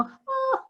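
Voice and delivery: a high mock wail of a crying baby; imitated baby wail. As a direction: squeal it like an abandoned baby. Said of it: "llorando así como".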